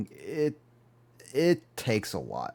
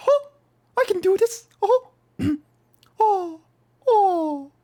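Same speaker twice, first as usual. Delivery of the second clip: very high, loud, squeaky cartoon mouse; Mickey Mouse impression; 5 dB louder than usual. Shown as a demonstration. Showing chat the Mickey Mouse voice, very high and squeaky. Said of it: "I've heard Mickey Mouse for such a long time".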